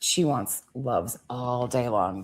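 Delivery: normal talking voice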